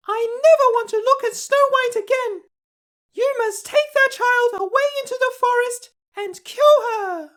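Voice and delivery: very high, loud queen voice giving a harsh order; cruel queen. Acted. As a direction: command the huntsman to kill the child.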